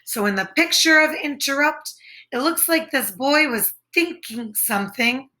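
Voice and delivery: ordinary talk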